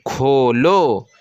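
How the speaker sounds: खोलो stretched slowly, kho-lo; slow blended reading. Shown as a demonstration. Teacher to pupils, slowly blending the joined word. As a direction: join the syllables slowly into खोलो. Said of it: "अलाम वापस लो".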